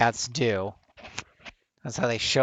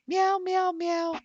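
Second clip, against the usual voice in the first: high, playful cat meows; silly kitty noises for the viewers; mock meowing; 3 dB louder, slower than usual. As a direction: meow like a cartoon cat. Meowing to introduce cat cam.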